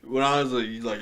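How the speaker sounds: ordinary delivery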